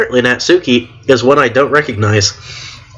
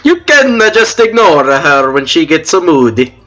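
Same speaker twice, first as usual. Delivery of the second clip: put-on crappy Italian accent. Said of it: "gave the crappy Italian accent to".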